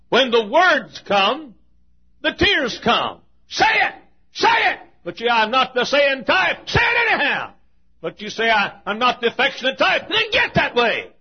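high, loud shouting command; shouted exhortation. Real, not acted. Preacher shouting at the congregation to say it. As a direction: shout them into saying it.

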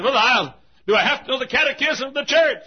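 high, loud, quick objection; voicing a pressing inquirer; insistent questioner. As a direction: fire it off as an astonished challenge. Acts a questioning listener.

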